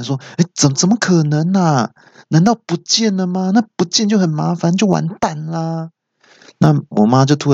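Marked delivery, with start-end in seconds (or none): 0.4-5.9 high-pitched, hammed-up fretting; acted panic. Son panicking to mum over a lost phone, acted panic. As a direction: play getting worked up, voice up high. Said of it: "我突然就开始紧张起来".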